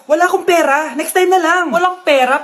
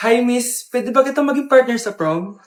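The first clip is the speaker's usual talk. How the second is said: suave prom invitation; 5 semitones lower than usual. Acted. Crush invites girl to prom.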